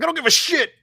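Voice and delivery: high, loud mock defiance; exaggerated defiant. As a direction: squeal it out, defiant. Retold defiant reply, big jump.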